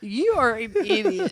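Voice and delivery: laugh-scold him playfully; playful insult. Real, not acted. Teasing husband over joke.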